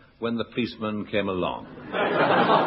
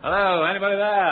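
raised call into an empty shop; 6 semitones higher than usual. Acted. Visitor calling into a Carnaby Street shop, raised. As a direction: call out hopefully.

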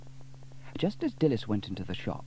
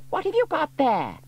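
high, loud fussy mum voice; nosy about his gadget; curious mother; 6 dB louder than usual. A narrator's reading. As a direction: Dilys asking Norman about his toy. Dilys asks son.

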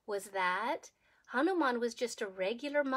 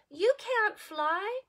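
mocking bird; 7 semitones higher, 5 dB louder, much slower than usual. Bird taunts monkey.

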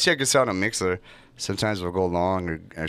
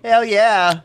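loud high hype yell; hype shout; 13 semitones higher, 9 dB louder than usual. Real, not acted.

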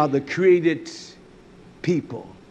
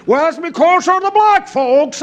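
high, loud caricature of a complainer; exaggerated mock outcry, pitched way up; mocking blamer; 12 semitones higher, 7 dB louder than usual.

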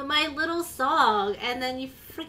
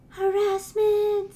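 mock outrage; 8 semitones higher, 6 dB louder, much slower than usual.

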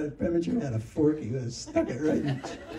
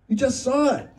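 much higher and louder, disbelieving; can't believe what he's hearing; imitated outraged disbelief; incredulous; 12 semitones higher, 6 dB louder than usual. Preminger exasperated at Bob.

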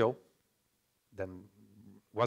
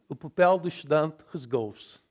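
louder European reading, endings swallowed; European Portuguese accent; 5 dB louder than usual. Shown as a demonstration. Teacher contrasting with Brazil, reduced European Portuguese. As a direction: read it as in Portugal, final vowels dropped.

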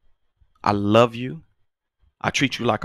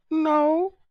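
put-on much higher, small kid's voice; voicing the daughter. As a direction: squeak it like a cornered child. Daughter's answer voiced high.

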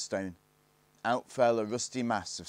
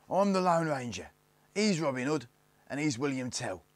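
high, loud snappy introductions; snapped; 7 semitones higher, 5 dB louder than usual. A narrator's reading. Eldest introduces the gang to Barney, snapping. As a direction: snap out each name, voice raised. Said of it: "snapped the eldest Snarget".

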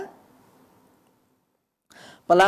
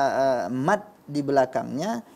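ordinary talk

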